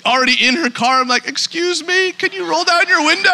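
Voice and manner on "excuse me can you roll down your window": high sweet polite voice, imitating himself; gentle high mock politeness; polite high call. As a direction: lightly mimic a polite request to a stranger. Retelling talking to lady in car.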